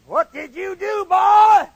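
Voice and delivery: high, building sergeant's bellow; Flanagan. Retold or imitated. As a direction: demand it, exploding on the last word. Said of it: "he talked just like this".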